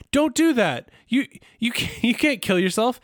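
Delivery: high-pitched alarmed protest; Bender. Retold or imitated. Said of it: "Bender's like".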